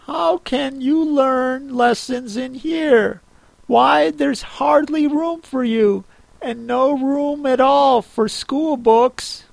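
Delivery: put-on high girl's voice, mildly scolding; Alice scolding herself. A narrator's reading.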